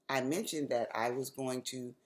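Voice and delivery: usual speaking voice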